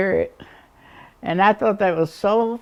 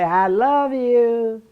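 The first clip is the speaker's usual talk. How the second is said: calling out love; 6 semitones higher, 3 dB louder, slower than usual. Retold or imitated. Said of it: "And I'd say".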